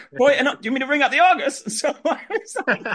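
high-pitched, laughing words; laughing through it. Real, not acted. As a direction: squeak it out while cracking up. Laughing while retelling absurd request.

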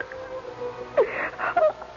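high sobbing wail; crying sob. Acted. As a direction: break into a sob. Breaks down on suicide tape.

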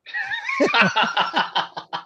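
high burst of real laughter; laughing. Real, not acted. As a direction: let out a big real laugh. Laughs at own theft joke.

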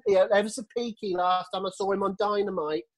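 high, laughing mock auntie voice; a worried old auntie clucking over him; fussy auntie. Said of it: "sounds like you're his auntie".

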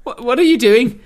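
loud, high, stammered challenge; mock-alarmed householder; baffled local. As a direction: demand an answer, voice shooting up. Plays baffled local confronting the English.

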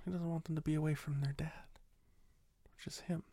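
conspiratorial whisper about Santa's reindeer. Acted. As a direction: let it slip as a secret.

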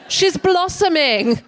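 high, slow mock-prim voice. Comic mocking a coy phrase about teenage girls, in a high twee voice. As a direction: put on a twee, prissy grown-up voice.

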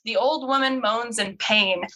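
ordinary talk, nothing marked

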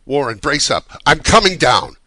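loud, high determined shout. A narrator's reading. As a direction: call out to rally a friend. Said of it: "I formed and shouted a resolution".

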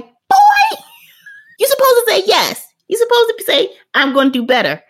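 high, loud scolding shout; scolding parent. Retold or imitated. Old-school parent scolding her son, loud and high. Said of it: "my twenty parent self wanted to be like".

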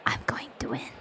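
hushed, confidential aside; whisper. Acted. Fighter confiding to the girl that they will win, whispered. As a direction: whisper it like a secret boast.

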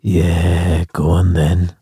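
deep, soft lazy koala drawl. Pretend. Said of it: "So it'd be like".

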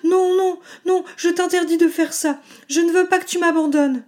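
high desperate screaming no. Acted. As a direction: scream it, begging him to stop. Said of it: "hurla-t-elle".

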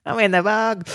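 high mock Australian; Australian woman assistant. Host echoes co-host as the lost phone, mock Australian woman. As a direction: echo it in a comic female voice. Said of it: "mine's got the Australian women's, uh, voice".

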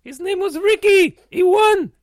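put-on loud, exasperated French-accented correction; angry correction. Interviewee corrects his forgetful partner, angry and loud. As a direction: hammer the answer at your partner. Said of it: "you got me all angry".

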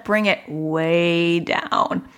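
very slowly bring yourself down; calming self-talk. Retold or imitated. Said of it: "there was an aha moment".

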